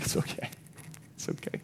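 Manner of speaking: very soft, near-whispered reassurance; hushed comforting. Retold or imitated. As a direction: murmur it to soothe a child. Father comforting son after X-ray.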